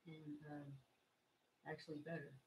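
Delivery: ordinary talk, nothing marked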